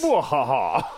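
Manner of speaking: hammed-up mwahaha laugh; evil villain cackle. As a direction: gloat like a cartoon villain. Villain laugh after boast.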